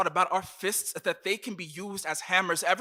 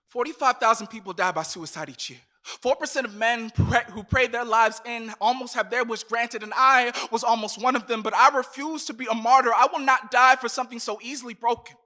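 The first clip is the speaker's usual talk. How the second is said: loud, higher, passionate slam delivery; impassioned; 5 semitones higher, 9 dB louder than usual. Acted. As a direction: build to a fierce climax. Climax of the poem.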